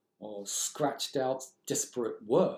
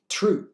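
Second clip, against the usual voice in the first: TRUE said high and loud; stressed word; 9 semitones higher, 9 dB louder than usual. Shown as a demonstration. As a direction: hit the word true with force. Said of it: "Look at the first word".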